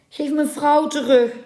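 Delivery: high-pitched, imploring merman; urgent plea for his wife; pleading. A narrator's reading. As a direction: beg across the water. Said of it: "ging smekend zijn stem over het water".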